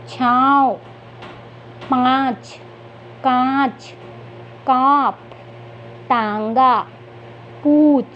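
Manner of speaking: drawn-out model words, each on its own; teacher's slow word list with pauses between words; reading practice. Shown as a demonstration. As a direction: read word by word for young learners, nasal vowels stretched. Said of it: "तो चलिए पढ़ते हैं".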